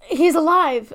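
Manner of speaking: high, loud cry of shocked joy; retold scream. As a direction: shout it like she just got a miracle. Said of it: "and she, like, screams".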